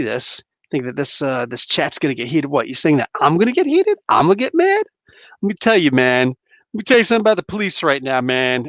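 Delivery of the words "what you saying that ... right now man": hammed-up indignant rant, pitch leaping up; mock outrage. Streamer feigns getting mad at his chat, mock outrage. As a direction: play at getting heated, louder and insistent. Mock outburst at being called heated.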